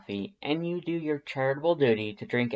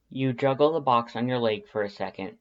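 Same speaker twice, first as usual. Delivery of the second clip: ordinary delivery, nothing marked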